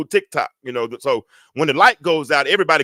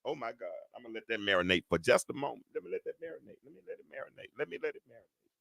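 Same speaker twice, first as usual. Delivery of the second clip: very soft, low murmur to oneself; hushed repetition, fading under the breath; muttered aside; 5 semitones lower, 17 dB quieter, faster than usual. Real, not acted. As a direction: let it sink in, nearly inaudible. Drops to repeated aside.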